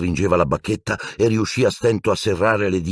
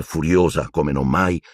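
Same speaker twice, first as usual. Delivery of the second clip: ordinary delivery, nothing marked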